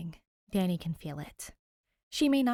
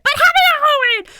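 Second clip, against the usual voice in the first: very high, very loud holiday cheer; excited shout; 19 dB louder than usual. Real, not acted. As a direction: belt out the greeting with joy. Shouting a holiday greeting to viewers.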